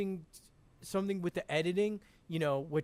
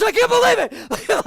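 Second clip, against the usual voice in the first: high, loud yelled burst; 14 dB louder than usual. Retold or imitated. Imitating the actor, high and loud yell. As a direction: shout it out of nowhere. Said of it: "and then he goes".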